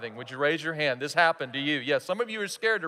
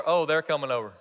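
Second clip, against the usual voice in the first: unexcited dread; 2 semitones lower than usual. Acted. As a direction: groan at news of unwelcome visitors. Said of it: "are they excited? ... Or".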